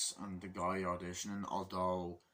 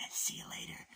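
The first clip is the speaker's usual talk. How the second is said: whispered laugh; 5 dB quieter than usual. Retold or imitated. Laura Dern's character dismisses him.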